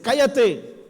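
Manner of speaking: sharp, high, loud shout of Cállate; angry hush. Retold or imitated. Someone silencing the believer, loud angry command. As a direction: bark the order to be silent. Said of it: "Uno te dice".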